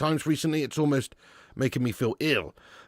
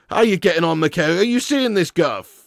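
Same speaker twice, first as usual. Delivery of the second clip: ordinary talk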